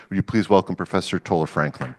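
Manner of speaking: usual speaking voice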